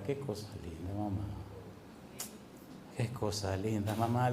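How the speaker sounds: soft, low, moved aside; tender marvel. Real, not acted. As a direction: breathe it out in wonder.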